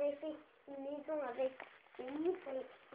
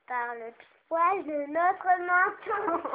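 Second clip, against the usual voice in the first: loud slow refusal; defiant pig voice; 2 semitones higher, 10 dB louder, slower than usual. Pretend. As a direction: refuse loudly, stretching it out.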